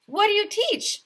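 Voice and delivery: high, curious question; mayor feigning interest; mock curious. A narrator's reading. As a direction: ask innocently. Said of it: "Mayor Hubble asked".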